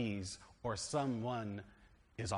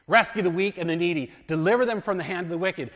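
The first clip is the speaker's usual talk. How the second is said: raised, higher voice proclaiming the verse; scripture reading; 4 semitones higher, 9 dB louder than usual. Acted. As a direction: read it out like a preacher at the pulpit. Reads scripture aloud.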